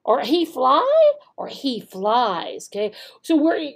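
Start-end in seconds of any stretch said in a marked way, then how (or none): 0.2-1.1 questioning tone. Shown as a demonstration. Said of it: "showing with our tone of voice which one is the correct answer". 1.5-2.7 confirming tone. Shown as a demonstration. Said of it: "showing with our tone of voice which one is the correct answer".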